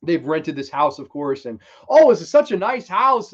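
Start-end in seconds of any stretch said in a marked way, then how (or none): 1.9-3.3 high, loud imitation of delight; mock gushing. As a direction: gush over it like an impressed guest. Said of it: "going on and on about how nice the house is".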